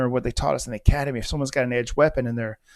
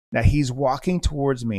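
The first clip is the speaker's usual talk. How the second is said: ordinary delivery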